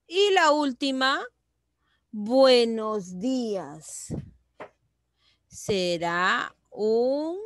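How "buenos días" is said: BUENOS DÍAS lento y alargado, cayendo; exclamación. Shown as a demonstration. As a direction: estira «buenos días» como saludo exclamado. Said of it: "una oración exclamativa". (exclamación lenta con final descendente)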